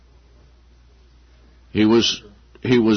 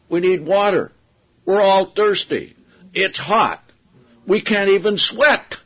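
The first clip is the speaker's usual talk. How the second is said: higher, complaining voice of thirsty people; whiny complaining crowd; 7 semitones higher than usual. Retold or imitated. Preacher voicing the grumbling crowd in the desert, whiny and complaining. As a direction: whine it like parched, grumbling people.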